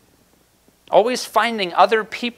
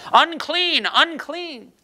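leper's cry; 8 semitones higher, much slower than usual. Retold or imitated. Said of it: "cry out".

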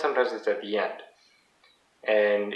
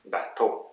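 French "Bateau", softer, ending emphasized; last-syllable stress; 3 semitones lower, 6 dB quieter than usual. Shown as a demonstration. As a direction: demonstrate "Bateau" with weight on "teau". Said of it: "the last one that is the most emphasized".

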